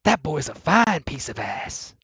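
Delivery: slow, lightly voiced maid character; a hint of a leering housekeeper, drawn out; mock-voicing the movie's maid; imitating the maid. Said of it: "she's like".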